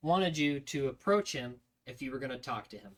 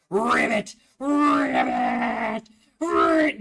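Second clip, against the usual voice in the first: frog croak screamed much higher and louder, building; screaming ribbit; 10 semitones higher, 9 dB louder, much slower than usual. Retold or imitated. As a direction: scream ribbit at fleeing recruits, rising each time.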